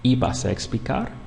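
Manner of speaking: "¿Ibas a explicar?" with the pitch rising at the end; question intonation. Shown as a demonstration. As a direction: turn "¿Ibas a explicar?" into a question by the tune alone. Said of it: "by changing the intonation of your voice into a question".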